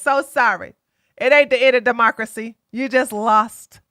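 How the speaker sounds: ordinary talk, nothing marked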